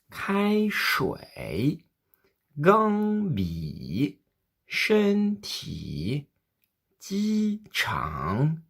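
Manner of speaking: Kai Shui, Gang Bi, Shen Ti, Ji Chang : syllabe haute puis bien descendre avant de remonter; premier et troisième ton modèle. Shown as a demonstration. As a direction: plonger au fond comme un manège de montagne russe. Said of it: "comme si c'était une sorte de manège de montagne russe". (modèle premier ton puis troisième ton qui descend et remonte)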